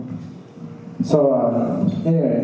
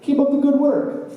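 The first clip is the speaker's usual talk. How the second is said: high, cheery boss voice; upbeat mock boss praise; boss praising; 11 semitones higher, slower than usual. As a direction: pat him on the back with your voice. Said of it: "pats you on the back and says".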